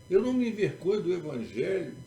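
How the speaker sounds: fast, high, loud proclamation; forceful preaching. Real, not acted. Preacher proclaiming the power of the Gospel, forceful preaching. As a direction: drive each phrase home to the congregation.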